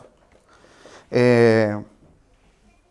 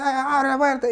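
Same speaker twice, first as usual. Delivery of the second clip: mock fatherly yell; 11 semitones higher than usual. Father ordering little boy to open the door, high mock yell. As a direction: mimic a dad yelling an order. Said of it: "el papá le gritaba".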